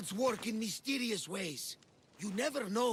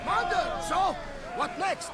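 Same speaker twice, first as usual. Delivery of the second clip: eager; 7 semitones higher, 6 dB louder than usual. Acted. Eager call to commander.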